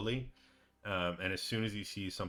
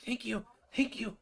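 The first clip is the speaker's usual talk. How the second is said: high, pleading thank-you voice, way up in pitch; grateful prisoner; 14 semitones higher than usual. Acted. Prisoner pleading with his rescuers, high and grateful. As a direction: beg and thank in a thin high voice. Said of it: "And he says".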